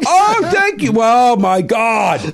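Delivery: very high, loud, shrill-edged gush; gushing Palin impersonation. Pretend. Mocking Palin answering the fan, high loud gushing. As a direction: gush like a flattered politician. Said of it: "acting Palin's gushing reaction".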